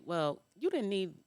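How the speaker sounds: ordinary talk, nothing marked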